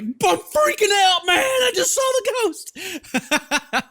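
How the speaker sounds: high, loud, slow mock panic; hammed-up screaming about a ghost; mock freak-out. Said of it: "And she's like".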